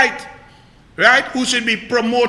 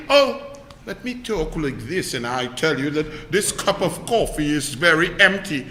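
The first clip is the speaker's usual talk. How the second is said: mocking impression; 5 semitones lower, 3 dB quieter than usual. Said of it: "that clown who feel".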